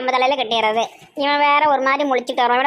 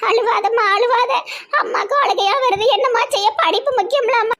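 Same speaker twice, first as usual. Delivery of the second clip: high, mock-weepy mother voice; tearful doting mother; 10 semitones higher than usual. Mother consoles daughter leaving for hostel, tearfully. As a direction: fuss over her as if about to cry.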